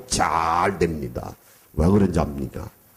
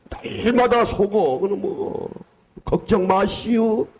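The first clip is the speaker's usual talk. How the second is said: Chungcheong brush-off; 11 semitones higher than usual. Retold or imitated. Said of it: "충청도 사람 전도하기가".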